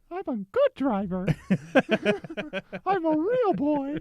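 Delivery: high, squeaky little cartoon voice; Jiminy Cricket voice. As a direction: chirp it like a tiny cartoon cricket. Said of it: "bizarrely good Jiminy Cricket impression".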